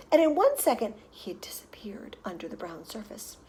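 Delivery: ordinary delivery, nothing marked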